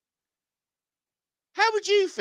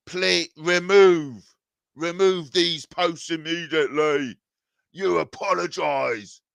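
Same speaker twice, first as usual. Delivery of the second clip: mock stern demand; slower than usual. Mocking a critic's demand.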